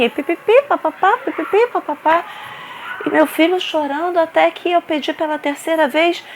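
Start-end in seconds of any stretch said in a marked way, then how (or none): 0.0-2.2 high sing-song babble; mocking gossip noises; mock chatter. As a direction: mimic gossip as nonsense syllables.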